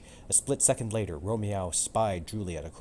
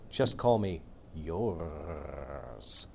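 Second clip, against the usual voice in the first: purred; 3 semitones lower, much slower than usual. Acted. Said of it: "Romeo purred".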